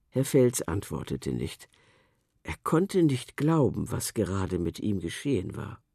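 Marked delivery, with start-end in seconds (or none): none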